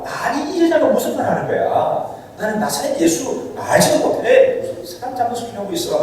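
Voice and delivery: high, indignant brush-off; Peter's denial. Acted. Peter snapping back at the servant girl, pitched high and indignant. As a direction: wave off the accusation, flustered. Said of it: "그러니까 베드로가".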